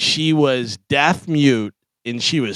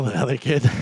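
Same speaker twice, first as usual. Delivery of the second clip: normal talking voice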